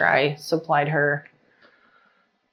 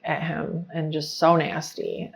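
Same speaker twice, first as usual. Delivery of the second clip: usual speaking voice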